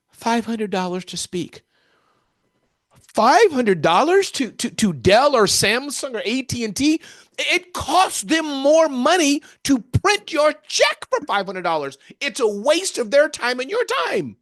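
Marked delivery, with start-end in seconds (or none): 3.1-14.4 loud, high-pitched disbelief; incredulous outburst. Acted. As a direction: exclaim it, aghast at such a lowball fee. Acted disbelief at friend's low fee.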